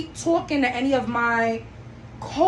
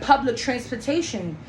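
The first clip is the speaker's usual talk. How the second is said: usual speaking voice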